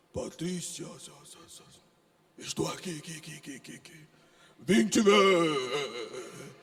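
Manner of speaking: low, echoing spooky voice ending in a loud, very slow boom; mock mystical God voice. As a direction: parody a mystical God speaking with echo. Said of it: "Deus fala assim". (imitação zombeteira da voz mística de Deus)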